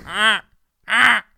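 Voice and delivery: loud, high mock goose honks; two short squawks like a goose. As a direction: honk like an agitated goose. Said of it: "Is that what goose sounds like?".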